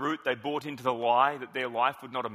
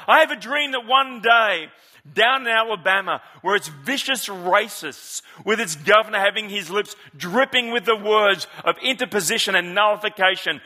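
put-on higher, louder Southern preacher accent; Martin Luther King accent; 4 semitones higher, 6 dB louder than usual. A pastor quoting the speech in a Southern preacher accent, louder. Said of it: "I just want to do his accent".

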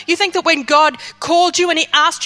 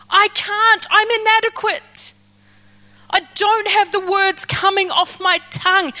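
higher, pleading cry to God; 5 semitones higher, slower than usual. Acted. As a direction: beg desperately, voice rising. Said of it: "he is crying out to God".

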